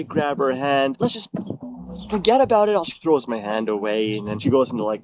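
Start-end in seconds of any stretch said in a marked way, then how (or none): 1.0-2.9 higher-pitched coaxing; imploring, raised-pitch quote; retold plea. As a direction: act out talking her round. Retells pleading while grabbing hand.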